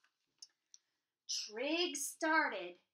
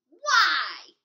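sudden loud gruff question; troll voice; 4 semitones higher, 18 dB louder than usual. Acted. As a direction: bark it out like a curious troll. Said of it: "the troll asked".

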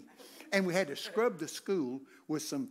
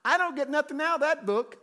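higher, louder blurt of refusal; blurted retort; 10 semitones higher, 6 dB louder than usual. Retold or imitated. Preacher retelling his answer to Jesus, loud stubborn blurt. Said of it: "Guess what came out of my mouth?".

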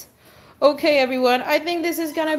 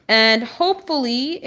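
normal talking voice

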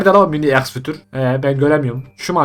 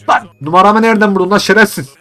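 high, loud angry shout at the caller; furious yelling into the phone; 6 semitones higher, 6 dB louder than usual. Real, not acted. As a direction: tell the caller off. Yells at caller.

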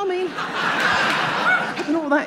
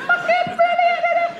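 very high squeaky excited cry; services lights calling; 4 dB louder than usual. Retold or imitated. Motorway services lights luring drivers, squeaky high cry. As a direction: lure like a cartoon sign. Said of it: "all them lights going like that".

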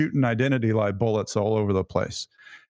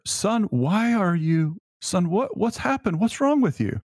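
put-on much higher, exasperated scolding of a child; impatient parent; 9 semitones higher than usual. Father scolding his young son, exasperated and much higher. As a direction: let your patience run out on him. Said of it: "I always used it with this tone".

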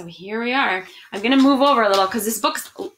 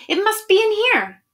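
much higher and louder, sure of the find; eager child; 10 semitones higher, 4 dB louder than usual. Acted. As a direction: squeal it like a kid who thinks they've won. Child sure of finding afikomen.

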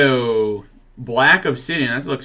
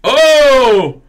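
excited; 8 semitones higher, 4 dB louder, slower than usual. Real, not acted. Excited pull, mock announcing.

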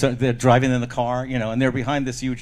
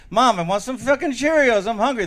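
higher-pitched whining demand; insistent kid; 6 semitones higher than usual. Acted. Hungry kid repeating himself to mother, insistent and higher. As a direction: voice a child pressing the point.

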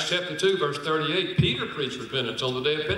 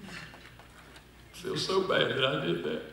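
remorseful husband; 5 dB quieter, much slower than usual. Retold or imitated.

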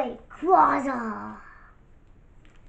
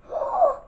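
sharp high gasp; 3 dB louder than usual. Real, not acted. Gasp while opening cards.

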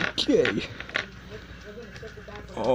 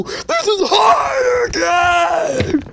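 high, drawn-out shouted complaint; frustrated wail; 7 semitones higher, 4 dB louder, slower than usual. Real, not acted. Gamer raging after failing.

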